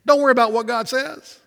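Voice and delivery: high, sly tempter voice of Satan; a high wheedling Satan voice, sowing doubt; Satan tempting. Acted. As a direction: coax like the serpent in the garden. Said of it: "Satan comes".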